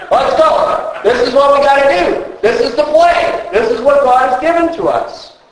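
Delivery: rallying. Acted. Rallying like a quarterback.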